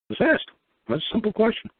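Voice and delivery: high-pitched emphatic advice. Real, not acted. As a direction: pitch jumps way up, insisting. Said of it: "emphatic advice".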